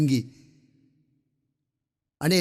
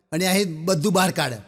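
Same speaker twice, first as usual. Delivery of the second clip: normal talking voice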